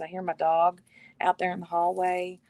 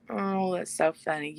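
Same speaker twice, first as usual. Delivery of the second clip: ordinary talk, nothing marked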